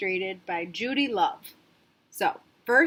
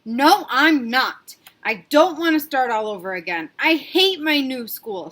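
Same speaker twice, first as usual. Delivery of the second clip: put-on stubborn child; 3 semitones higher, 6 dB louder than usual. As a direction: dig in your heels. Sarah refuses school.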